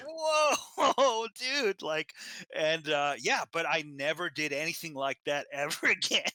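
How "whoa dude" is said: high, loud retold shock; mock-alarmed high exclamation; startled reaction. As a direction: recoil aloud, laughing through it. Retold reaction to uncle's outburst.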